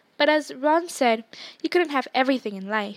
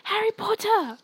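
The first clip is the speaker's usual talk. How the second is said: high-pitched excited squeal; Ginny Weasley; 8 semitones higher than usual. A narrator's reading. Younger sister pointing at Harry Potter, squealing. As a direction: squeal the name like a thrilled little girl. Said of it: "she squealed".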